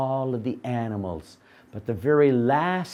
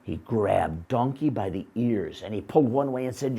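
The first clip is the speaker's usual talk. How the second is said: plain reading voice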